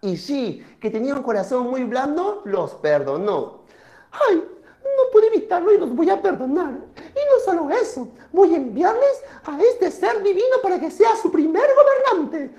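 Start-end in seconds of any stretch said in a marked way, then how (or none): 4.1-12.5 high-pitched moon deity, animated; a much higher put-on voice, calling out at the end. Moon decides to forgive and send a divine being, high and theatrical. Moon's voice in the myth.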